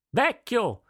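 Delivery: "Vecchio!" high and loud, shouted over the hammering; girl yelling "Vecchio!" at her blacksmith father; yelled at full lung. Acted. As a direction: bellow "Vecchio!" to be heard over the forge. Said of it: "urlò Nihal con quanto fiato aveva".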